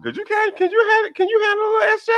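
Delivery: high squeaky teasing voice; teasing falsetto. Pretend. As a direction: tease him in a thin falsetto.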